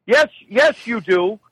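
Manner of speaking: high mock-insistent mother. Mother overruling her son's denial, high insistent voice. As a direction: imitate a mother contradicting her son. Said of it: "where she'll go".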